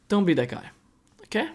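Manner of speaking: normal talking voice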